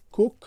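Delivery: کوک, a short quick oo; English word cook. Shown as a demonstration. Teacher introducing English cook with short oo. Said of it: "کلمهٔ دیگر است".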